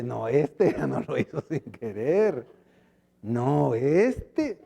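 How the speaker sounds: slow, doubting half-laugh; mock the excuse, unconvinced; suspicious scoff.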